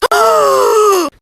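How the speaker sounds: high, drawn-out gasp of sudden realization; big high-pitched gasp for show; shocked realization gasp. Shown as a demonstration. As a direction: demonstrate an oh-my-gosh gasp. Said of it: "It goes like this".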